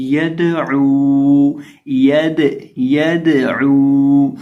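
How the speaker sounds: यदऊ drawn out, the दाल bounced with a little echo; shaken qalqala on दाल. Shown as a demonstration. Quran teacher showing students the qalqala bounce on यदऊ. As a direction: vibrate the दाल in यदऊ, slow and ringing. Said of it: "इसको हम हिलाकर पढ़ेंगे".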